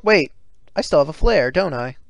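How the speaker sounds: ordinary delivery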